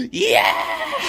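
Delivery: very high, loud whoop; shrieking victory yeah; triumphant yell. Real, not acted. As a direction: cheer at your own joke. Cheering own joke.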